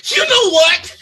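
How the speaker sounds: loud, high, laughing outburst; snapping shout. Acted. Angry nine-to-fiver starts yelling at the customer. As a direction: let the pressure burst out at him.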